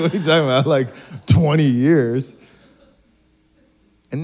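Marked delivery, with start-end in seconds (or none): 0.0-2.3 loud, raised disbelief, played for laughs; acted amused astonishment; mock incredulity. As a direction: react as a baffled kid. Twelve-year-old's disbelief, retold.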